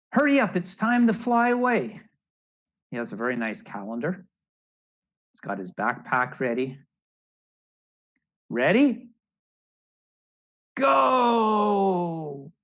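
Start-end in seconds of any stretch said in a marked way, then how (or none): 0.1-2.0 much higher, louder hurrying call; urgent parent bird. Acted. Parent bird rushes Rufus to fly away, high and loud. Parent urging chick to leave. 8.5-12.5 high, loud shout of go; excited launch shout. Acted. Narrator cheers Rufus off on his flight, shouting Go.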